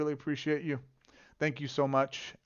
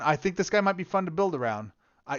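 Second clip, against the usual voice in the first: ordinary talk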